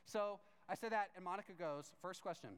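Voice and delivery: ordinary talk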